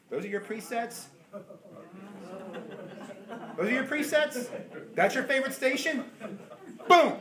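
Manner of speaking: tease, then snap the dial; God teasing. Acted. God to a believer about his favorite station, teasing laugh.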